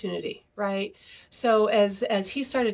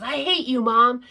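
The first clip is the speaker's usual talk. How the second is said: put-on a burst of child's fury, louder on Mom; angry child's outburst; 3 semitones higher, 7 dB louder than usual. Four-year-old yelling at his mom, mock angry outburst. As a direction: lash out like a furious little boy.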